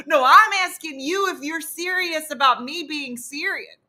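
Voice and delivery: high, loud retold comeback; indignant. Woman arguing back in the foyer, indignant. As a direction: insist back in mock argument.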